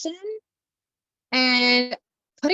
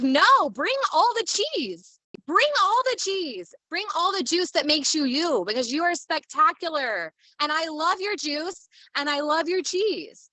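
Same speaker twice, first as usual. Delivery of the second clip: champion them with big, playful gusto; exuberant encouragement; 6 semitones higher than usual. Retold or imitated. Cheering on the girl's cheesiness.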